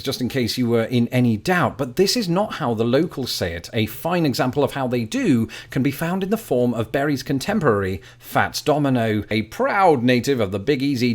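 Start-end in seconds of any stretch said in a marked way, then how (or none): none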